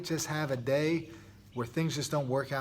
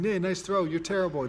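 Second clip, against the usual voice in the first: taunting opponent; 5 semitones higher, 5 dB louder than usual. Retold or imitated. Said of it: "The other kid saying".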